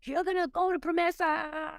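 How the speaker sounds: mild mock weeping, high and pleading; retold crying. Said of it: "crying and saying".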